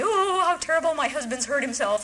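high, sugary fake concern; mock sweet distress. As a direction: play the innocent wife, voice lifted high. Mock sweet phone voice to 911.

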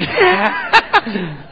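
a loud, high laugh at himself; high burst of laughter. Real, not acted. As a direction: laugh out at how silly it was. Laughs at his own question.

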